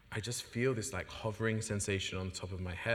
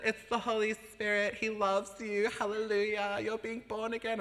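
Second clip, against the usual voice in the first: high, bright excited church lady; friend's joyful voice; 12 semitones higher, 4 dB louder than usual. Retold or imitated. Friend announcing the Holy Spirit, high and joyful. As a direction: beam with joy. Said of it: "She said".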